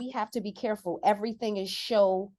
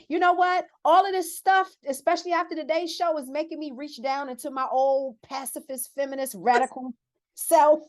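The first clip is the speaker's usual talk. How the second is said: higher and louder, building to a near shout; fired-up outburst; 5 semitones higher, 4 dB louder than usual. Real, not acted. Building outrage about politics.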